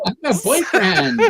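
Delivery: laughing through a mock brush-off; laughing mock rebuff. To her cohost, laughing mock brush-off. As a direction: laugh as you fend off a pretend suitor.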